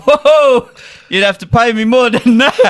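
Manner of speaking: mock shocked, laughing; laughing retort. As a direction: push back through laughter. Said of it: "I was like".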